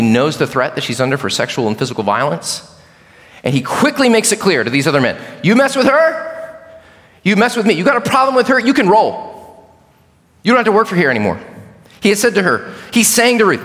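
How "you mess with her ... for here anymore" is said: protective warning. Retold or imitated. Boaz warns the young men.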